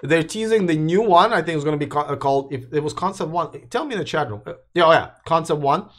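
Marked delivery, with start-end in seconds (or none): none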